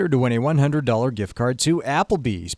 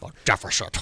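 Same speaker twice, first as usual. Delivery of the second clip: Sean Connery impression; 7 semitones lower, 3 dB quieter, faster than usual. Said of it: "That was more Sean Connery".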